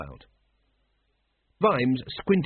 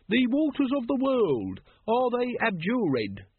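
slow, high-pitched ritual question; intoned; 11 semitones higher, slower than usual. A narrator's reading. Brother Watchtower leading the lodge ritual, high and slowly intoned. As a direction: chant it with drawn-out ceremony. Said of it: "intoned Brother Watchtower".

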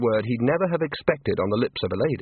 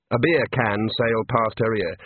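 plain reading voice

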